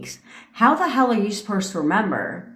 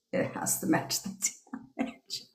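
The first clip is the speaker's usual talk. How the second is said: whispered aside; 13 dB quieter than usual. Real, not acted. Repeats rule, then whispers it.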